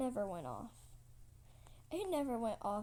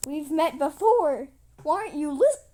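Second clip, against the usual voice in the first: higher, loud insistence; exasperated shout; 4 semitones higher, 16 dB louder than usual. Acted. Trigger insisting to the queen, exasperated shout. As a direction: shout to be heard.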